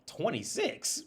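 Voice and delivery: high-pitched retold disbelief; incredulous question. Guessing the waitress's age, high incredulous question. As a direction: ask it high, as if checking a wild guess. Acts out own surprised guess.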